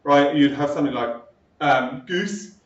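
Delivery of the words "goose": "goose" with the vowel far forward; "goose" made at the front of the mouth. Shown as a demonstration. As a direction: say "goose" with a fronted oo, towards "geese". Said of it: "it's closer to the front of your mouth".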